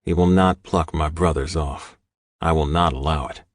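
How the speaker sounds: plain reading voice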